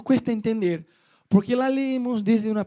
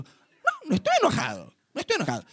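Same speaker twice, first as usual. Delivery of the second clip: imitating the neighbour; 8 semitones higher than usual. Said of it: "Que algunos hablan así, yo hablo así".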